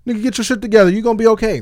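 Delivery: cousin's high, loud tough-love push; tough-love pep talk. Retold or imitated. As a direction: snap him out of it. Cousin tells him to toughen up.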